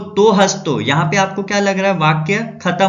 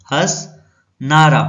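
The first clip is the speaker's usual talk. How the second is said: हंसनारा drawn out very slowly, broken after हंस; slow, split word; 3 semitones lower, 3 dB louder, much slower than usual. Shown as a demonstration. Teacher forming another word from हंस, slow and split. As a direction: say हंसनारा in two stretched parts, हंस then नारा.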